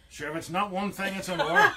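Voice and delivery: rising to a loud laughing "another"; mock weary resignation. Answering a melter's complaint, loud laughing resignation. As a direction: shrug it off with a laugh. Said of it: "And you go".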